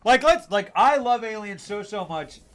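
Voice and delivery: bursting with love for the film, loud and high; excited fan outburst; gushing excitement. Real, not acted. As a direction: gush like a superfan. Gushing about loving Alien.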